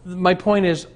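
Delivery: ordinary delivery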